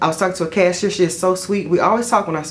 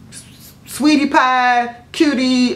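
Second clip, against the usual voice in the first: sweetie pie; 5 semitones higher, much slower than usual. Acted. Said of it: "So I go from being".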